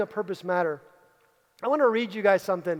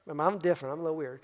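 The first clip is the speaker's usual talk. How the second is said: mock weird murmur; 5 semitones lower, 6 dB quieter, faster than usual. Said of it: "I know it sounds like".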